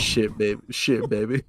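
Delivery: low, soft seductive growl; imitating Tyler's Vine, low and husky; low sultry quote.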